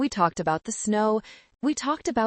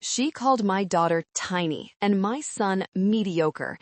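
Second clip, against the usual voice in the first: usual speaking voice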